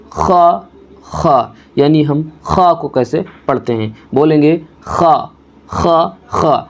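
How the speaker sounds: "خا" rasped from the throat; letter demo. Shown as a demonstration. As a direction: scrape "خا" in the throat. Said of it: "حلق سے ہی ادا کریں گے".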